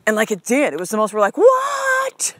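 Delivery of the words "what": squeaky high shocked "What?". Retold or imitated. Mom and son amazed the bell rang on zero, high surprised squeal. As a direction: gasp it out in delighted disbelief.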